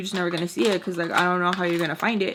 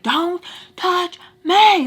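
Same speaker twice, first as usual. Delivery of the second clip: loud, high, slow warning shout; really loud; 10 semitones higher, 8 dB louder, much slower than usual. Retold or imitated. Narrator yelling at a woman grabbing her, loud and slow. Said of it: "like, really loud".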